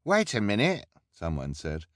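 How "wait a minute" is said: much higher, louder crowd voice; interrupt, suddenly doubtful; a bystander objecting; suspicious onlooker. A narrator's reading.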